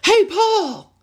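high-pitched holler to get a friend's attention; imitated shout. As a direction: call it out sharply across a room. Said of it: "I used to shout at my friends".

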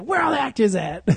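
high-pitched comic demand; hammed-up comedian impression; Chris Rock. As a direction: call it out high as an irate comedian. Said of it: "I fucking love Chris Rock".

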